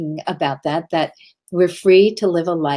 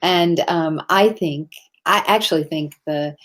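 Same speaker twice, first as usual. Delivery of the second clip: usual speaking voice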